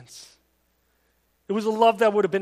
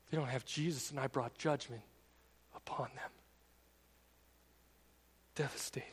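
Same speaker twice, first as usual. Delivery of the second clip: hushed; 6 semitones lower, 13 dB quieter than usual. Real, not acted. Somber confession.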